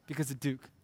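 ordinary talk

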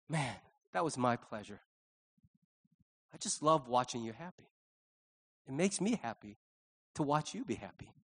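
soft, low, with tender pauses; loving and gentle. Acted. God delighting in watching his children happy, gentle and low. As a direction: say it quietly, as a contented father. God's loving answer to thanks.

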